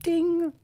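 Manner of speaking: a high sung ding like a quiz bell; mock bell ding. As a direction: chime it high like a prize bell. Praising fan art with bell.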